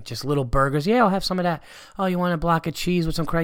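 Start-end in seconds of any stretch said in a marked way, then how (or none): 0.9-1.6 eager guest. Retold or imitated. Mock eager party guest. 2.0-3.4 offering waiter. Retold or imitated. Mock waiter offering food.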